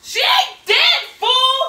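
high, loud, punched words; imitating exasperated grandma. Granddaughter voices grandma answering hysterical mom, exasperated shout. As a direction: shout it word by word, scolding. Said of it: "And she was like".

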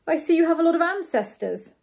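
a hint of jealousy between the words; jealous tone. A narrator's reading. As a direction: say it with envy creeping in. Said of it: "in a jealous tone".

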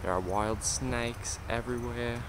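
mock southern tour-guide accent, slow; vague southern accent. Tour guide in the Everglades, mild put-on southern accent. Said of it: "I don't even know what accent I'm doing".

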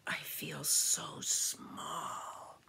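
slow, soft, whispery; tiny, awed snail. A narrator's reading. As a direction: whisper it as someone feeling very small. Tiny snail speaks to whale.

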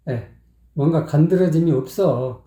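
usual speaking voice

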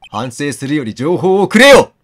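loud retort; exasperated outburst. Real, not acted. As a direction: demand it, fed up. Player retorts at character.

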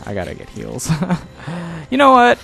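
ordinary delivery

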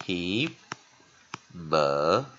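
"Himalayas" very slow, broken into hi, ma, lay, yas; syllable by syllable. Shown as a demonstration. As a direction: model "Himalayas" with a long pause after each syllable. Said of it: "再看一遍".

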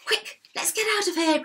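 high, hurried cry of alarm; mildly panicked storybook cry; urgent cry. A narrator's reading. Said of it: "cried the biggest billy goat".

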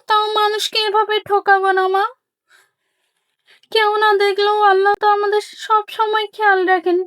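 high, sincere pleading; earnest daughter. Acted. Daughter urges mother that God sees everything, high and sincere. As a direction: appeal to her conscience.

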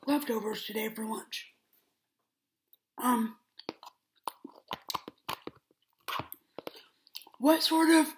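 speaking between spoonfuls; talking while eating. Real, not acted. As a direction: food in mouth. Seen 0: Spoon to mouth at 2.9 and 7.2; talks between bites.

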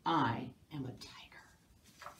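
slow, low, breathy whisper. Acted. Mouse whispering its claim, slow and low. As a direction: whisper it like a tiger creeping up.

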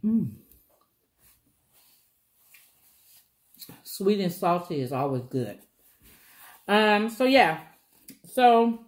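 talking while chewing food; muffled by mouthful of bread; mouth full, chewing. Real, not acted. Seen 3: Bites corn, then bites bread roll, chews while talking throughout.

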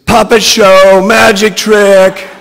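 high, very loud chanted scream. A narrator's reading. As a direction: scream it out like a wild show announcement. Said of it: "Brittany screams".

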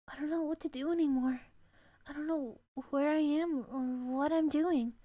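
normal talking voice

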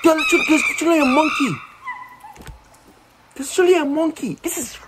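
very high-pitched squealed outburst; startled disbelief. Real, not acted. Startled by a monkey in game.

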